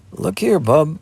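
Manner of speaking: plaintive. Acted. Lad pleading with the hidden girl to take the milk, plaintive. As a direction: coax a stubborn kid, half begging. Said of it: "the lad went on plaintively".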